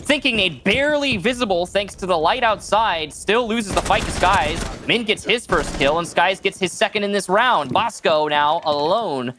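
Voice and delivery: excited live play-by-play; esports caster. Acted. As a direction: call the action fast and rising.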